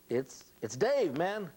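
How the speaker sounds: slow, high, loud plea through a door; acted exasperated caller. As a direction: stretch it out, insisting to be let in. Acting out locked-out man at door.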